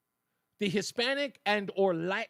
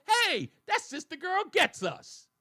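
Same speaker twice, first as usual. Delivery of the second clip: mock quote; 10 semitones higher than usual. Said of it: "are gonna turn around and say".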